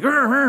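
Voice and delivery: high, loud mock revving noise; playful vroom-vroom growl; imitated engine revving. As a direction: rev like an impatient driver cutting in. Impatient drivers rushing in traffic.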